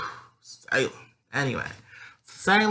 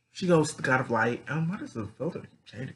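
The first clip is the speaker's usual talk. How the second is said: ordinary talk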